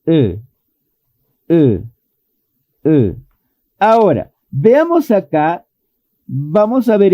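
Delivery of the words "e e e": "E" as a short sound between Spanish e and i, lips barely apart; a short "E" with the jaw just dropping; short lax vowel. Shown as a demonstration. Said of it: "debe de sonar de esta forma". (una "E" corta, entre la e y la i española)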